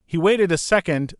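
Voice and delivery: usual speaking voice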